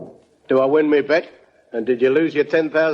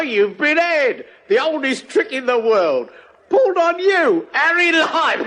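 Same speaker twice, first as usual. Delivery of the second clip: gloating; 10 semitones higher than usual. Acted. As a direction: rub it in with relish. Gloating at conned Harry.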